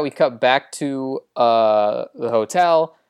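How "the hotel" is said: normal talking voice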